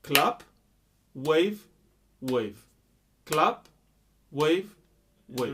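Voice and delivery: loud, evenly spaced beat cues; counted clap pattern. Shown as a demonstration. Teacher showing a different type of clapping, spoken beat cues. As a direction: call out each clap and wave on the beat. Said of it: "a different type of clapping, like this".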